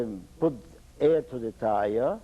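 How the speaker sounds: ordinary delivery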